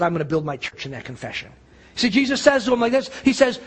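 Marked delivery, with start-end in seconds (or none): 0.0-1.5 low, soft and earnest, lean in on the promise; hushed solemn promise. Retold or imitated. Pastor voicing Jesus' promise to Peter, hushed and low. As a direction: say it quietly like a sacred vow.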